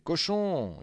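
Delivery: loud, high jeering shout; street yell. A narrator's reading. Street urchin jeering at Morin, loud yell. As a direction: hurl the insult down the street. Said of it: "un voyou dans la rue criait".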